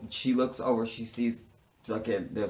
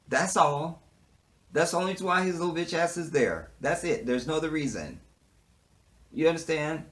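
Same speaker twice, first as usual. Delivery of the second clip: heated rant; 6 semitones higher than usual. Real, not acted. Ranting at the cop character.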